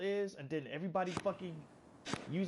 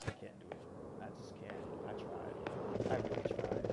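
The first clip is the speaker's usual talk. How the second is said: breathy whisper, much lower and softer; defeated whisper; 7 semitones lower, 6 dB quieter than usual. Real, not acted. Drained gamer admits defeat in a whisper. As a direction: barely get it out, drained.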